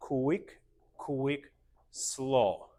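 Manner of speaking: rhythmic calls, each word on its own beat; long SLOW, QUICK rising then held level; dance step count. Shown as a demonstration. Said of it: "So we go slow".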